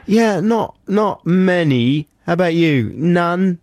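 high-pitched, tentative phone check-in; mock phone call. Acted phone call between the two writers.

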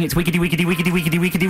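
ordinary delivery

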